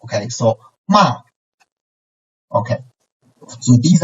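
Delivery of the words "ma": ma falling sharply from high; fourth tone. Shown as a demonstration. Teacher models ma for repetition, falling fourth tone. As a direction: start ma high and drop it suddenly. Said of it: "as high as the first tone and suddenly make it down".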